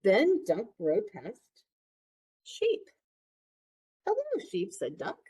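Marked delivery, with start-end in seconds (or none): 2.4-2.9 name bleated way up high like a sheep; bleating sheep. Acted. Reading animals to child. 4.1-4.7 squeaky high cartoon duck greeting. Duck greets farm animals.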